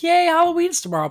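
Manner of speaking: high, loud mock-excited "yay"; excited cheer. Host telling co-host how they should feel, excited. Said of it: "I should be excited".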